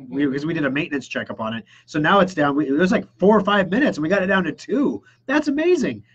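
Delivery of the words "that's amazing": amazed. Retold or imitated. Customer amazed by boot time.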